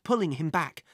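plain reading voice

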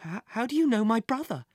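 shaken, halting at the first word; starting to quiver. Acted. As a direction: let the voice begin to waver. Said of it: "his voice starting to quiver".

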